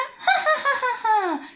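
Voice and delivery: risa aguda y burlona; ríe alto como la luna traviesa. A narrator's reading. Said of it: "se reía". (la luna se burla con una risa aguda)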